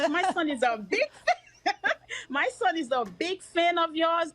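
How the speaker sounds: excited. Retold or imitated. Excited mom meets star.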